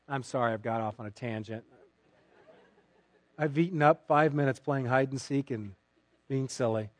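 ordinary talk

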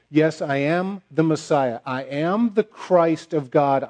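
slow, every word weighted; slow solemn declaration. Acted. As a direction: declare it slowly and firmly. Jesus's declaration voiced.

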